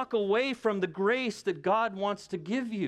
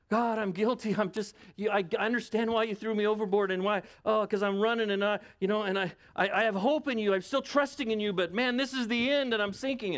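higher, rambling acted plea to God; pleading Jonah; 4 semitones higher than usual. Sinking Jonah confessing to God from the sea, pleading. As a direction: stumble over the words, pitched up, pleading. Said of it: "you know how Jonah feels, right?".

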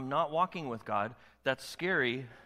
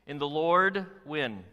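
slow call and response; 5 dB louder, much slower than usual. Shown as a demonstration. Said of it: "Listen to what he says".

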